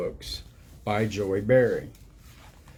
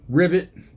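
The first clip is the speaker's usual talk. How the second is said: a perky, higher croaked ribbit; playful frog call; 7 semitones higher, 7 dB louder than usual. Pretend. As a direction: croak it like a cheeky cartoon frog. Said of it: "that means selfish in frog".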